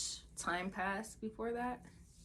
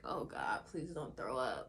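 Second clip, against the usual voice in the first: nauseous murmur; 7 semitones lower, 6 dB quieter than usual. Retold or imitated. Nauseous patient pleading with herself, queasy murmur. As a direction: lower and softer, queasy. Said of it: "I was like".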